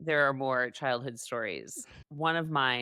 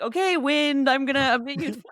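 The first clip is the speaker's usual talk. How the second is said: high, louder voice for a pretend tree; mock tree voice; 10 semitones higher, 6 dB louder than usual. Joking about trees making wind, mock tree voice. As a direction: play a tree giving orders, pitched up. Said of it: "not like a tree is like".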